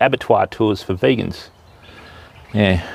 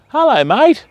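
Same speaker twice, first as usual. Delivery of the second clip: cooing greeting; 13 semitones higher, slower than usual. Real, not acted. Greeting a bird by the water.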